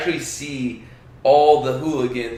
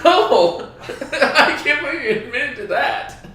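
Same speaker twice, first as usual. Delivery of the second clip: high, loud laughing protest; laughing disbelief; 13 semitones higher, 4 dB louder than usual. Real, not acted. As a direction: crack up while you tease them. Teasing a friend's nerdy admission.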